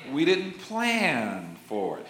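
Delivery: slow, each word weighed, stress on "plan"; "plan" stressed, said slowly; slow emphasis. Shown as a demonstration. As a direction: spell it out slowly for the congregation. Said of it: "let me say that one again".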